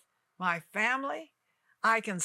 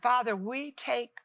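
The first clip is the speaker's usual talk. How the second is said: prayer; 2 semitones higher, slower than usual. Acted. Televangelist praying over her viewers, hushed and slow. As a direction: pray it gently over the viewers.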